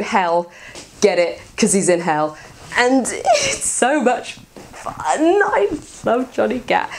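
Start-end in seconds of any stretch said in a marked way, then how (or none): 2.8-6.9 high, slow, laughing delight; giddy gushing. Real, not acted. Fan telling viewers how much she loves the game, high and giddy. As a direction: swoon over the character.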